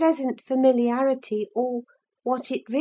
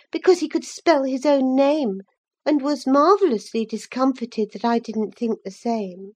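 plain reading voice

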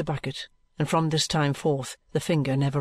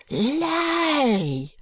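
much higher French shriek of denial; crying out; 12 semitones higher than usual. Acted. Maid shrieking at the detective's accusation. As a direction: shriek the word. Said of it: "cries mademoiselle".

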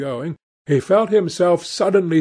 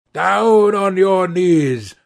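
slow, louder, raised-pitch command; growled order; 5 semitones higher, 5 dB louder, slower than usual. A narrator's reading. Gunman to his captive, growling an order. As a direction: order him down, drawing out each word. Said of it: "growled the man".

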